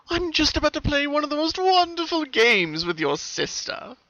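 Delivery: put-on high, sing-song sinister boy voice; creepy colt. The colt taunting the caged filly, creepily sweet. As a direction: sweeten it like a friendly murderer.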